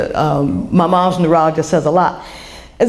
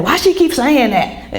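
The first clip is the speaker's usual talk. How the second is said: put-on high, drawn-out child's complaint; higher-pitched mock kid whine; imitating rude kids; 6 semitones higher, slower than usual. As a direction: mimic a tactless kid, pitched up and slow. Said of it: "kids are rude and that kind of thing".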